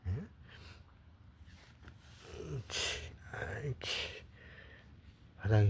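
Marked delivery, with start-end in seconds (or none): 0.1-4.3 hush-praising a pet gently; soft whispered praise. Real, not acted.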